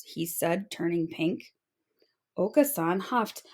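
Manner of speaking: ordinary delivery, nothing marked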